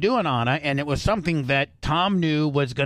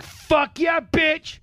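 triumphant shout; 12 semitones higher, much slower than usual. Retold or imitated. Host reliving the punch he landed, shouting in triumph. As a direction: crow it like you just won the fight. Gloating after landing a punch.

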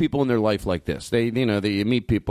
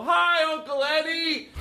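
much higher, singsong chorus reply; crowd response; much slower than usual. Retold or imitated. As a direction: chime in like the eager audience. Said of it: "And I would always go".

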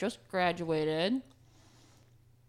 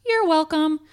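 smug; 9 semitones higher, 8 dB louder, slower than usual. Acted. Cheeky sign-off in ad.

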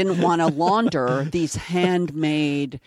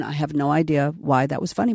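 ordinary talk, nothing marked

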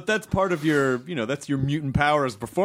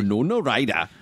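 imitated, lower Newcastle accent; attempted Geordie accent; 4 semitones lower than usual. Shown as a demonstration. Host practising after the actor's praise, attempted Geordie accent. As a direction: try the name in Geordie. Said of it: "There you go, you're a Geordie".

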